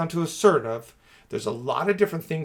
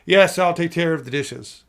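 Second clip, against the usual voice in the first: louder, higher, at odds with the words; raised voice, begrudging agreement; contradicting tone; 4 semitones higher, 8 dB louder than usual. Shown as a demonstration. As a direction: say yes with a loud, pushed-up tone that undercuts it. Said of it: "we might do it like this".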